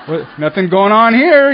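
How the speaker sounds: awkward mock prayer. Awkward first prayers on knees.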